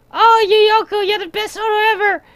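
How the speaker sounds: very high, fast cutesy creature voice; Yukuri voice. Retold or imitated. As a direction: squeak it out like a tiny blob creature gushing. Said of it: "the Yukuri was like".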